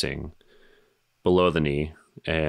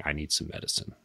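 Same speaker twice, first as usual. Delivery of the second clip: flat lifeless monotone, much softer; 8 dB quieter than usual. Retold or imitated. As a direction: say it drained and monotone, barely there. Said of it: "in a super monotone voice".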